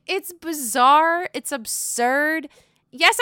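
ordinary talk

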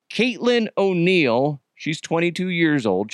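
ordinary talk

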